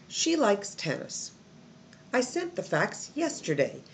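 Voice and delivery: confident statements, each closing on a downward "tennis", "yesterday"; statement falling tone. Shown as a demonstration. Teacher models statements for learners, pitch falling at each end. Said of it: "at the end of the sentence, your pitch falls down".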